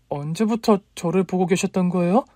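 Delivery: high, startled question. A narrator's reading. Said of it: "화들짝 놀랄 수밖에". (화들짝 놀란 높은 목소리)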